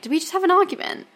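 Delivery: high, puzzled, half-asleep question; confused question. Retold or imitated. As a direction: ask it up high, bewildered. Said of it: "being like".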